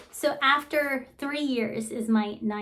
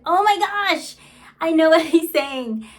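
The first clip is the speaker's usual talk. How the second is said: higher, louder burst of sudden understanding; excited realization; 5 semitones higher, 7 dB louder than usual. Real, not acted. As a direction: blurt it out as it clicks. Mother suddenly understands son.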